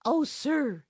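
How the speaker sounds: high, loud imploring cry; imploring the enchanter for mercy. A narrator's reading. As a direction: plead with him, pitched high. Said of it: "she implored".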